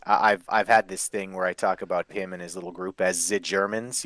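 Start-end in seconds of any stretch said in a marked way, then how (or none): 3.3-4.0 mock German accent.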